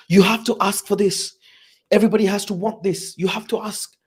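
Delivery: high-pitched, insistent coach imitation; Pep Guardiola. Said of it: "he talks like that".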